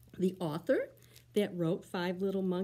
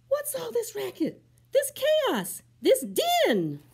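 much higher, louder scolding; scolding mama; 9 dB louder, slower than usual. Acted. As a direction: tell off noisy kids.

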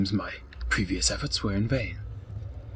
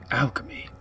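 whispered; 4 semitones higher than usual. Pretend. Whispered aside.

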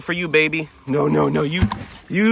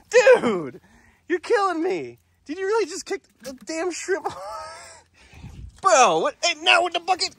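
exasperated disbelief. Real, not acted. Camel kicks the bucket.

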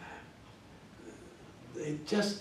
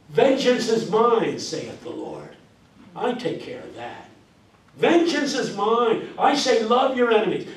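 mock preaching; 5 semitones higher, 5 dB louder than usual. Teacher preaching the Bible back at the preacher. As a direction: proclaim it like a pulpit. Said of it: "the Bible says what?".

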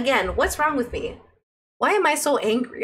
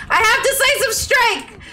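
excited; 9 semitones higher than usual. Real, not acted. Repeats louder, laughing.

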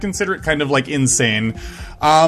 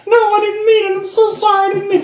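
high squeaky begging voice; high-pitched pleading little victim; pleading level voice; 10 semitones higher, faster than usual. Acted. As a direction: beg for mercy in a tiny high voice. Level begs forgiveness.

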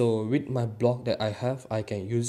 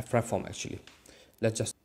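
ordinary delivery

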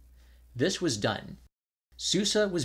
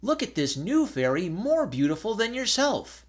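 high, louder teasing call; coaxing cry; 9 semitones higher, 3 dB louder than usual. Acted. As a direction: tempt her out with a bright call. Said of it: "cried they".

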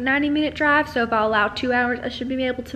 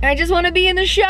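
higher, louder, fast whiny plea; Lucy moment; 7 semitones higher, 6 dB louder, faster than usual. Retold or imitated. Quoting Lucy, pleading to be in the show. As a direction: beg like Lucy to get in the show. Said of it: "like, Lucy moment".

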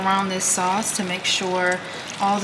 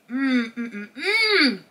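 hummed mm sounds rising into a laugh; savoring hums; 3 semitones higher, slower than usual. Real, not acted. Vlogger tastes her Alfredo, happy hums and a laugh. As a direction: hum with pleasure at the food. Tasting her meal in bed.

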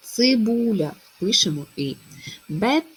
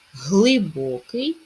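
Глибокий slow and louder, leaning on the stressed И; 2 semitones higher, 7 dB louder, much slower than usual. Shown as a demonstration. Teacher slowly stressing the И in Глибокий. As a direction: stretch Глибокий and press the И. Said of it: "Наголошується И".

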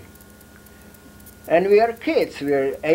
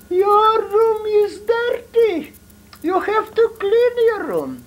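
put-on very high, slow falsetto old woman with a crying edge; scolding grandmother; slower than usual. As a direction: squeak it out like a fussing grandma. Grandmother scolding in retold story.

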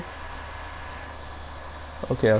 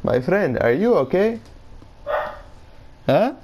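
put-on mild Indian accent, climbing high on you; lightly put-on Indian accent, rising question; 6 semitones higher than usual. Asking a friend in a playful Indian accent. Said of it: "I'm using the Indian accent".

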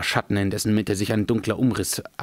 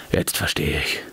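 much lower, heavy wizard voice; grim old wizard; 7 semitones lower than usual. A narrator's reading. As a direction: realise it with dread. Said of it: "murmelte Gandalf".

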